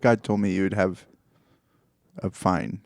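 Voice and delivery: ordinary talk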